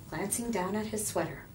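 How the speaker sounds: plain reading voice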